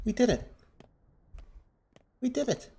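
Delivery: high relieved cheer; excited. Real, not acted. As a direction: celebrate the escape. Triumph, higher pitch.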